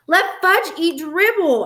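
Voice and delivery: high, drawn-out outraged cry; screaming. A narrator's reading. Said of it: "I screamed".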